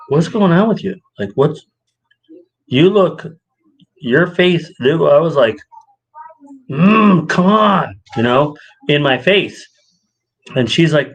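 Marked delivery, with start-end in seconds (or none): 0.1-4.7 higher-pitched mock confrontation; imitated questioning wife. Wife asks her husband what's wrong, puzzled and higher. 6.7-8.0 higher-pitched exasperated groan into words; acted impatience. Husband stuck behind shoppers in Walmart, exasperated.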